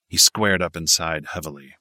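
plain reading voice

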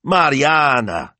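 high, loud, stretched-out name; exasperated high-pitched rebuke; scolding. A narrator's reading. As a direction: scold your sister by name. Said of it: "Signor Barbaro scolded".

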